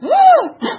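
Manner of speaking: big shocked gasp, high and loud; a loud high intake of breath; sharp high gasp. Retold or imitated. Shock at fake diamond.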